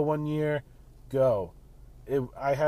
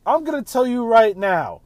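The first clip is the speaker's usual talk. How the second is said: raised, much louder and higher declaration; yelling; 6 semitones higher, 7 dB louder than usual. Real, not acted. Warning his listeners before he yells at them, loud. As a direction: announce it loud, building up to a yell. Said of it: "I'm gonna yell at you".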